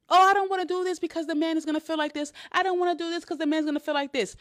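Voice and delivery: high, hurried mimicry of fretting; mock worried whine. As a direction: rattle it off high like an anxious excuse. Mocking women who seek approval.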